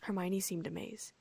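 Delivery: plain reading voice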